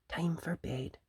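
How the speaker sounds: gentle hushed bedtime call; soft whisper. Acted. Missus Rabbit leading Peter to bed, hushed. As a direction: hush it so the sisters stay asleep. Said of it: "she whispered".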